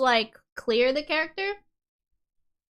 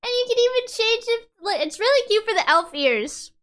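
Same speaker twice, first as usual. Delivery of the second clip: excited; 9 semitones higher, 4 dB louder than usual. Real, not acted. Excited about ear sliders.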